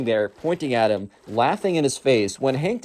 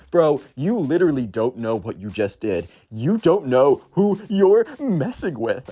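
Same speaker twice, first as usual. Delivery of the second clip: mock tough emo kid; 2 semitones higher, slower than usual. Emo kid squares up to Hank, slow mock threat. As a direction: drag it out like a wannabe tough guy.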